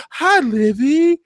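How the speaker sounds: high, slow, sugary mock greeting; sweet mock greeting. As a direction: coo the name like a sly mother. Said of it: "She says".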